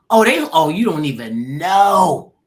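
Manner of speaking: loud, drawn-out indignant outburst; loud exclamation. Retold or imitated. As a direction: stretch the last word out loudly. Exclaiming over how badly treated.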